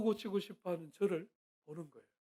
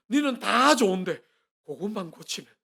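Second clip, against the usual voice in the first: mock praise then criticism; 10 dB louder than usual. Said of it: "그다음 말을 하기 위해서".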